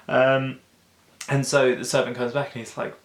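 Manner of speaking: normal talking voice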